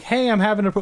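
high complaining customer. Pretend. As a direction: put on a caller's complaint. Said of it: "they're like".

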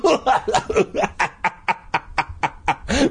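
long staccato acted laugh; burst of laughter. A narrator's reading. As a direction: cackle in a long rising run. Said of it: "burst out laughing".

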